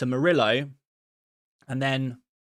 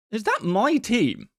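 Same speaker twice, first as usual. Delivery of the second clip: surprised; 8 semitones higher, 3 dB louder, slower than usual. Real, not acted. Sees viewer's team matches his.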